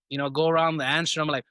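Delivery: normal talking voice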